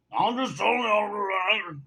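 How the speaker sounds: drawn-out, high mimicry of an arguer; mocking imitation. Bartender arguing over who drank what, mocking imitation.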